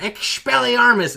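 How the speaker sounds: high, slow, loud spell cry; yelled spell. Retold or imitated. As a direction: shout the spell like a kid wizard. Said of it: "yells out in the chat".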